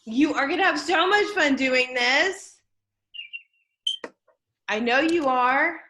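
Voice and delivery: loud, upbeat encouragement; enthusiastic. Real, not acted. Teacher cheering on her writing class, loudly excited. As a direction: pump the class up with big energy.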